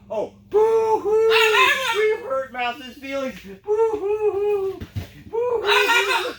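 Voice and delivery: high, slow fake blubbering boo-hoo; mock crying. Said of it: "she goes like this".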